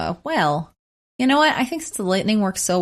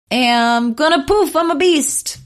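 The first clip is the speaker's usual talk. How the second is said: playful beast voice; 7 semitones higher, 5 dB louder, slower than usual. Acted. Transforming into a beast form.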